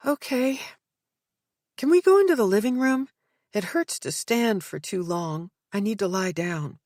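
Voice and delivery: put-on high, pained young woman; weary young woman. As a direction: ask it weakly, hurting. Hurt daughter asks to rest.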